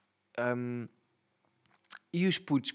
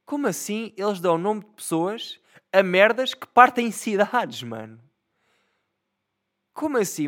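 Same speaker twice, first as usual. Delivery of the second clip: higher and louder, indignant ranting; outraged rant; 6 semitones higher, 7 dB louder than usual. Real, not acted. Fed up with whoever names the storms, loud indignant rant. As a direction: rant about it, pitch up and loud.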